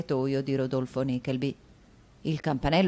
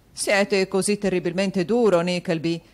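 protesting; 4 semitones higher, 5 dB louder than usual. Acted. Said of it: "protestò il signor Mantalini".

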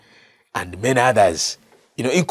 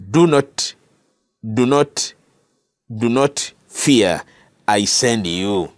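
reassuring God; 2 semitones lower, slower than usual. Acted. God's reassurance voiced slowly.

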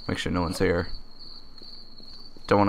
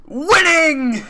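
high gleeful yelp of victory; triumphant shout; 4 dB louder than usual. Real, not acted. Gamer crowing over his survival. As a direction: crow it out like a winner.

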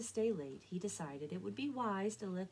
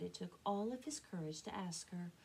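ordinary delivery

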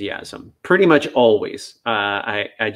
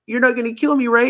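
higher, small worried question; timid dog; 11 semitones higher than usual. Pretend. A fearful dog asking the trainer, timid and high. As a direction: ask nervously if you're safe.

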